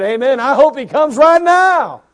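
loud, high, slow joyful cry; excited amen. Retold or imitated. Preacher retelling his answer to a gentleman, excited. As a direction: shout your hope to the rafters. Exclaiming hope for the return.

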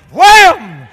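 explosive loud "wham"; shouted impact noise. Retold or imitated. Man throwing rat at oak tree, explosive impact noise. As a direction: blast it out like a heavy hit.

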